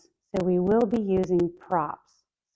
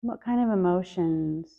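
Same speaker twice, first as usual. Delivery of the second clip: guided meditation. Acted. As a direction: ease them inward, gently and unhurried. Leads a breath meditation.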